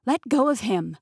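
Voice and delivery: loud, high furious demand; angry shout. Acted. Heroine shouting at Peter to release Tate, furious shout. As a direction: yell it at him to free Tate.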